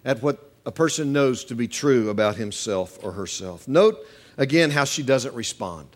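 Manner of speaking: usual speaking voice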